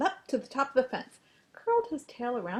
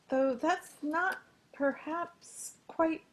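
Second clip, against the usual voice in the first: slow, higher, hedging politely; tactful hesitation; 8 semitones higher, slower than usual. A narrator's reading. Pig politely judging duck's crow, slow and hesitant. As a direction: drag it out, searching for kind words.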